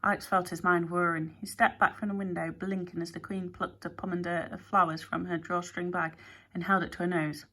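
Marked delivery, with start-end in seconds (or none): none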